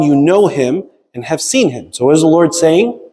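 ordinary talk

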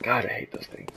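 breathy whisper while sneaking; hushed sneaking complaint. Real, not acted. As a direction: whisper so they won't hear. Sneaking past enemies.

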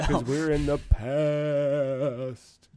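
slow, drawn-out ghostly voice; haunt the line like a ghost; spooky ghost voice. Acted. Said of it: "That's my spooky ghost voice".

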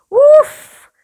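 sudden high loud woof; comic loud woof for the kids; loud mock woof. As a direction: blurt a silly woof. Mock reaction to smelly monkey.

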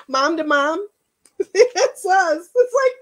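high, loud, on the edge of crying; tearful pride. Real, not acted. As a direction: laugh through welling tears. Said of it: "I wasn't gonna cry".

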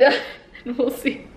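ordinary talk, nothing marked

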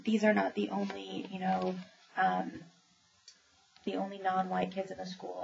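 ordinary delivery

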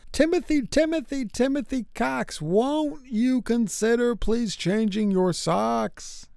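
copter announcement. Acted. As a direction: pitch up and plead down from the sky. Helicopter declaration to Tim.

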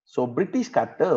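ordinary talk, nothing marked

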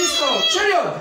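sharp high martial-arts command; barked order. Acted. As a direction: bark it like a taekwondo master. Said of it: "grito fuerte".

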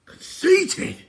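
high, loud shriek of outrage; outraged shout. A narrator's reading. King throwing Eilonwy's insult back, outraged high shout. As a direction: shout it back in disbelief, pitch leaping. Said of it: "shouted Edeleg, his eyes popping".

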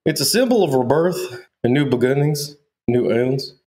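a teasing Southern twang on rebirth; mock Southern accent. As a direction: imitate her Southern way of saying rebirth. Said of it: "You're saying all things weird now".

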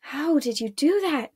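a touch of amazement; awed. A narrator's reading. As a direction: ask it in wonder, pitch lifted. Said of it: "Marella asked in awe".

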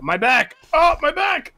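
mock pained cry, pitch shooting up on the second; hammed-up hurting hero clutching his back; pained groan. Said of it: "being like".

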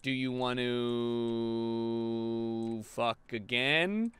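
very slow, low, drawn-out come-on; mock seductive. Player propositioning a game partner, mock seductive drawl. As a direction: stretch it out low and suggestive.

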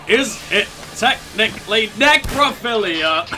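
slow robotic imitation; mock it like a robot. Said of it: "makes it sound even better".

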